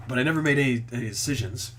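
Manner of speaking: ordinary talk, nothing marked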